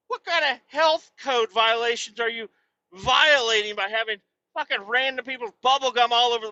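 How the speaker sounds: ordinary talk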